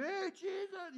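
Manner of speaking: high, loud yelled call; the irritating loud guy calling out to Jesus; yelling. Retold or imitated. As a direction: holler at him over the crowd. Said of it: "this guy's yelling loud beside you".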